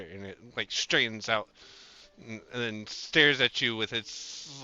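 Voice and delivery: ordinary delivery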